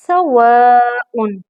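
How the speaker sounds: सवाउन with a short स and no i, slow; सवाउन drawn out; correct sa, slow. Shown as a demonstration. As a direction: say सवाउन slowly, not सिवाउन. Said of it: "हम कहते हैं सिवाउन".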